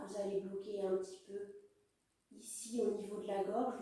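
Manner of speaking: normal talking voice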